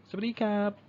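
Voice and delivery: high, cheery Thai-style hello; Thai greeting. Shown as a demonstration. Teacher greeting a friend at school, cheery Thai hello. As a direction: greet the class brightly in Thai. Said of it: "you say hello or hi to them".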